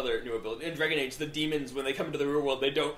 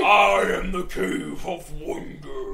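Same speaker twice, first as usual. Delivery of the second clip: grinding stone statue; 3 semitones higher, 5 dB louder, slower than usual. Retold or imitated. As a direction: intone like an ancient stone guardian. Imitating Aladdin's cave guardian.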